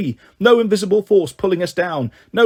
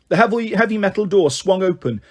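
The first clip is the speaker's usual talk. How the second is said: ordinary delivery, nothing marked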